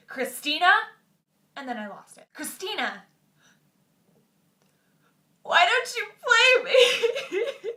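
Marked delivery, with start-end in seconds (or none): 0.1-0.7 loud call across the gym; retold yell. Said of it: "and I yelled". 2.3-7.7 high, slow, sobbing plea, much louder; retold sobbing.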